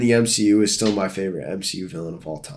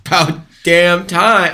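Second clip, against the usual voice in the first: mock exasperation; 7 semitones higher, 6 dB louder, slower than usual. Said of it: "I'm just kidding".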